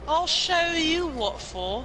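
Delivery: high, slow, put-on British accent; hammed-up British threat. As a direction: stretch it out like a cartoon Brit picking a fight. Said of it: "And I'm bloody British".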